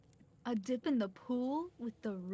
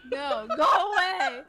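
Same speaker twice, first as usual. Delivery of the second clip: loud playful rejection; 8 semitones higher, 10 dB louder, slower than usual. Acted. Rejecting Trickster's advance.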